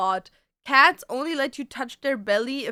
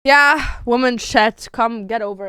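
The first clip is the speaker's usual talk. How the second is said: loud joking; 10 dB louder than usual. Real, not acted. Joking loudly to chat.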